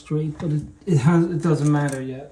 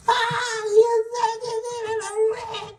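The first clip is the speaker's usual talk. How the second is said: helper's voice. Retold or imitated. Retelling helper's excuse again.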